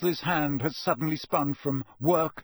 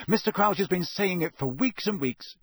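swift; 6 semitones higher, 3 dB louder than usual. A narrator's reading. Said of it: "said Percy swiftly".

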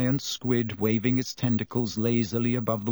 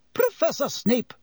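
high, sharp shriek; 13 semitones higher than usual. A narrator's reading. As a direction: cry out to stop him. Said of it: "shrieked Madame Pomfrey".

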